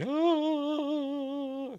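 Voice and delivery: a high, far-off cartoon "ah" as he drifts off; a thin, high wail fading into space; mock falling cry. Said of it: "as he floats away like that".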